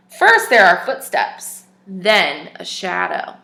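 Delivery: clear, even storybook reading voice; story narration. Acted. Teacher reads a picture book to children, storybook narration. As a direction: read the tale aloud to little listeners.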